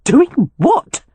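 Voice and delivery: a clipped, annoyed retort from the housekeeper; curt question. Acted. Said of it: "she asked curtly".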